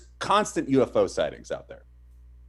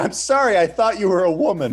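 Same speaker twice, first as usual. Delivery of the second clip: mock apology; 8 semitones higher, 6 dB louder than usual. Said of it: "And you're like".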